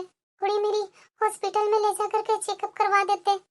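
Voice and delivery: weak, pleading request. Acted.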